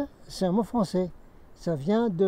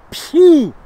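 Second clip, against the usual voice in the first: spray hiss; 9 semitones higher, 12 dB louder than usual. Retold or imitated. Miming pepper spray into bear's nose.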